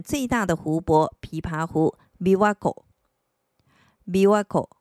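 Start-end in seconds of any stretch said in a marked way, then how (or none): 2.2-2.8 琵琶湖 read as Japanese "Biwako". Shown as a demonstration. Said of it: "那么就要念成こ".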